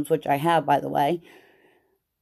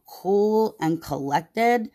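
usual speaking voice

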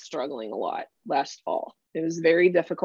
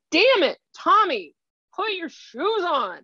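snapped, much higher and slow, fed-up parent scolding; exasperated parent; 11 semitones higher, 4 dB louder, slower than usual. Retold or imitated. Parent scolding a child over shoes, exasperated and higher. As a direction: bark it like an impatient parent. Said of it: "what people do is like".

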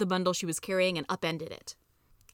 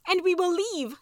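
high, loud answering declaration; rousing rebel's proclamation; rallying cry; 11 semitones higher, 5 dB louder, slower than usual. A narrator's reading. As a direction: take up the cry, pitched way up. Handmaids rally one after another.

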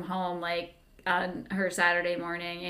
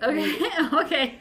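giggly, flustered agreement; laughing okay; 6 semitones higher, 3 dB louder than usual. Retold or imitated. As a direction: laugh through the okays. Laughing at the odd request.

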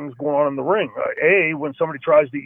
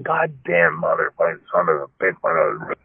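cussing in pain; 7 semitones lower than usual. Retold or imitated. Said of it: "he's cussing".

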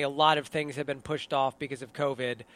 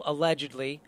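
ordinary talk

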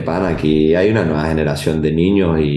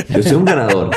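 boastful; 4 semitones higher than usual. Retold or imitated. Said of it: "le digo yo".

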